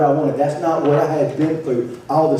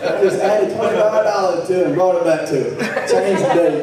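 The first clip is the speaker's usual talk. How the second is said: laughing; 3 semitones higher, faster than usual. Real, not acted. Laughing while telling.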